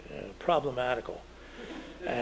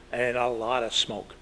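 usual speaking voice

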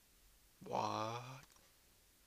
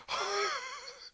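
high squeaky laugh; 3 dB quieter than usual. Real, not acted.

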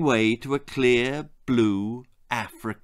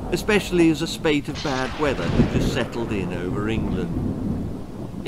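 ordinary delivery, nothing marked

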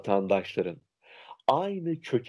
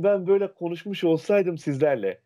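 higher, put-on provincial voice; mock dialect voice; 6 semitones higher than usual. Shown as a demonstration. Showing students a funny put-on way of talking: higher mock dialect voice. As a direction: play a comic local speaker to the students. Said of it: "Komik de oluyor".

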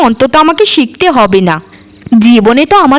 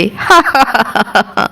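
sly laugh; 4 dB quieter than usual. Acted. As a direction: laugh to herself over the planned theft. Scheming maid gloats alone. (চাপা কুটিল হাসি)

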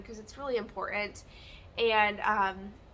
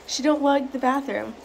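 higher and louder, teasing; loud, higher teasing to the pet; 3 semitones higher, 10 dB louder than usual. Real, not acted.

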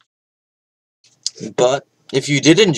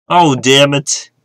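louder, slow, drawn-out curse; frustrated outburst; 4 semitones higher, 6 dB louder, slower than usual. Real, not acted. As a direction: let the frustration burst out.

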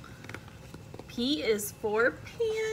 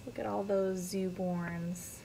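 plain reading voice

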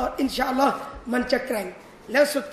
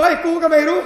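louder, higher blunt brush-off; husband's gruff retort; 4 semitones higher, 9 dB louder than usual. Acted. Husband stumped by hard verse, blurting loudly. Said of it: "เดี๋ยวเขาจะบอก".

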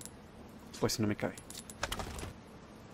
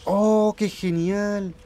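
delighted; 7 semitones higher, much slower than usual. Real, not acted. Delighted by preserved game map.

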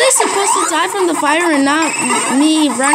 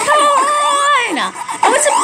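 high shouted complaint; exasperated yell; frustrated come on; 9 semitones higher than usual. Real, not acted. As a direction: protest at the game. Frustrated loss.

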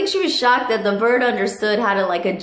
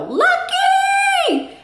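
high, louder squawked call; parrot calling out; 4 dB louder than usual. Retold or imitated. Parrot at the window calling the dog, high squawk. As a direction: call the dog's name like a parrot. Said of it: "and go".

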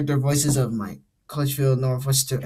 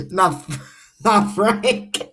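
high, cracking up mid-line; real laughter breaking through the words; laughing; 10 semitones higher, much slower than usual. Real, not acted. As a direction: lose it laughing at your own slip. Cracks up over a botched line.

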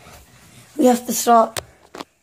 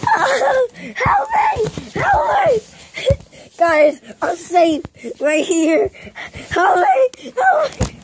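playful cries for help; 9 semitones higher, slower than usual. Pretend. Play fight, crying for help.

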